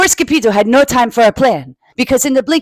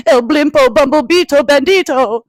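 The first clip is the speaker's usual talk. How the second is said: dramatic announcement; 5 semitones higher, 5 dB louder than usual. Acted. Dramatic name of villain.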